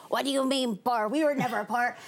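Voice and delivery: imitating dad's Mexican accent; light Mexican accent impression. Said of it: "my dad with his thick Mexican accent".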